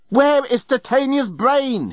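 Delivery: high, drawn-out angry yell; demanding yell. A narrator's reading. Journalist gripping Leovinus by the lapels, yelling slowly. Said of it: "he yelled".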